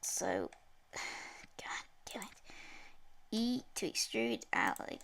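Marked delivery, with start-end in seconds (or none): 0.0-2.3 sighing, whispered curse; frustrated sigh. Real, not acted. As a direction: exhale hard, then curse softly. Software fails again.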